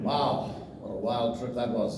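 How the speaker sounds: ordinary talk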